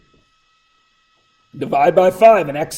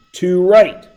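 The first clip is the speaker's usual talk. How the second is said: ordinary delivery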